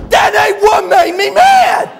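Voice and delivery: slow, high, furious mock bellow; hammed-up tongue-tied roar; Buddy. Buddy corrects the preacher, bellowing in worked-up rage. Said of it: "when he gets real worked up".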